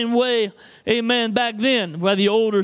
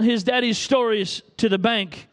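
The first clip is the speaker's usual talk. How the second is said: usual speaking voice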